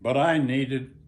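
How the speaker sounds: memoir reading. Acted. Author reads his memoir aloud.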